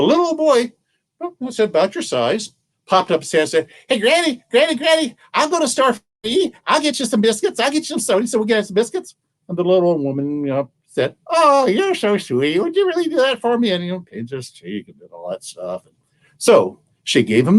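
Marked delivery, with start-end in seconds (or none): 3.9-9.1 put-on high, excited small-boy voice; eager little boy. 11.3-13.7 put-on high, fond old-lady voice; doting granny. Granny fondly thanks the boy.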